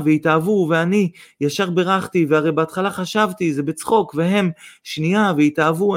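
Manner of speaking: high, breathless-sounding gushing; nonstop excited babble. A narrator's reading. Mother tells every passer-by the news, gushing. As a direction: gush on, tripping from thought to thought. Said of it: "דיברה בלי הרף".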